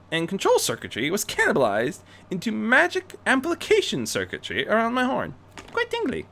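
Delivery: Rarity, smug. Acted. Rarity telling Bruce she outwitted his safeguard, smug. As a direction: gently gloat.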